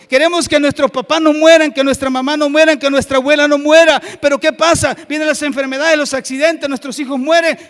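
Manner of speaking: loud, high, piled-up sermon list; full-force preaching. Real, not acted. Preacher listing family losses to the congregation, full-force preaching. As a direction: drive each phrase home at full volume.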